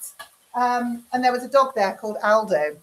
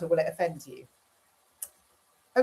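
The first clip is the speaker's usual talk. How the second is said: normal talking voice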